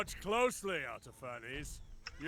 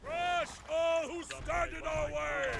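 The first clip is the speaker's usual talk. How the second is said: fierce command; 8 semitones higher than usual. Acted. King urging his army onward, high slow war cry. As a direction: bellow for the enemy to be crushed.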